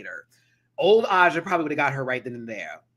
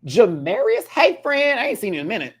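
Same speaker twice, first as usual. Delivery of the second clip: put-on a louder, higher hey friend to an old buddy; excited greeting; 5 semitones higher, 6 dB louder than usual. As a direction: greet him like you just spotted him across the room. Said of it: "I was like".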